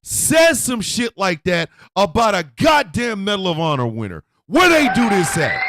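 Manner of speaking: high, loud, slow sing-song outraged yelling; furious rant. Real, not acted. A comic raging at a general's erasure with high furious yelling. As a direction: spit out the alliterative insults in full outrage. Ranting about the Medal of Honor.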